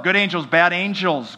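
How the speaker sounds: ordinary delivery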